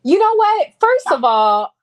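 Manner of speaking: loud, high-pitched telling-off; indignant retort. Real, not acted. Mother on live rebuking her son, indignant. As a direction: cut in loudly, indignant at being corrected.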